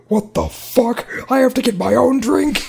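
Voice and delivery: loud, high-pitched mock outrage; hammed-up indignant complaint. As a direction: play an outraged customer who can't believe it. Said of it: "like, indignant about it".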